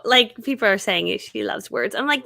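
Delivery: usual speaking voice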